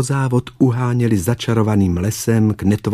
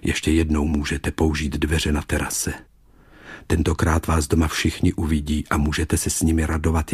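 low, soft, subdued beast voice; dying beast's farewell; 5 semitones lower, 4 dB quieter than usual. Acted. Beast releases Julie to visit her father, low and resigned. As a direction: let the last words fade almost to a whisper.

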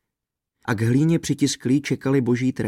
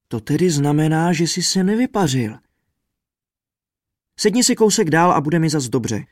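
higher-pitched voice of a put-upon friend; raised, mildly whining story voice; complaining trumpeter; 4 semitones higher, 4 dB louder than usual. A narrator's reading. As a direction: grumble up high at your companion. Squashed trumpeter goes on complaining.

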